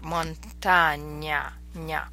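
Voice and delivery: "montaña, ña" drawn out, ny as one sound; slow "montaña", then the nya syllable alone; Spanish ñ sound. Shown as a demonstration. Teacher giving a Spanish example, slow ñ. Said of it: "like the ñ of Spanish".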